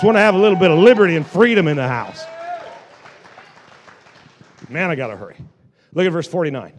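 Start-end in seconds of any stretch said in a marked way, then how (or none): none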